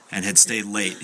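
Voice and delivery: ordinary talk, nothing marked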